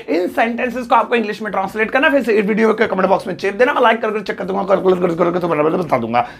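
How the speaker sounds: rattling it off fast; rapid-fire homework instructions; speaking quickly. Real, not acted. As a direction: rush through the instructions, words tumbling out. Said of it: "जल्दी जल्दी बोलता हूँ मैं".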